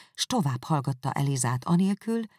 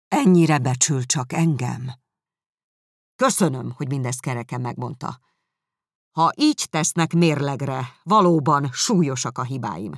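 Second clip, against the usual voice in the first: bitter sarcasm; 7 dB louder than usual. Acted. Darcy bitter reply.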